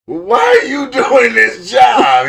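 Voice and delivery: high, drawn-out, loud mock exasperation. Retelling a baffled question about someone's job, mock exasperated. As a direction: imitate an incredulous shout, stretching it out.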